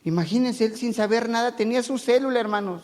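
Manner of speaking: normal talking voice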